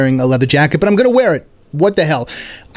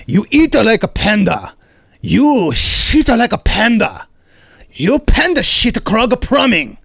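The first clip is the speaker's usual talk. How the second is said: put-on slow Korean accent rising higher, accusing; Korean landlord accent; 5 semitones higher, slower than usual. Landlord accuses tenant of clogging pipes, Korean accent. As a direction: build the blame in a Korean accent. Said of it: "my Korean landlord".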